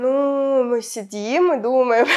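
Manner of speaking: usual speaking voice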